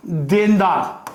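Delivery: ordinary talk, nothing marked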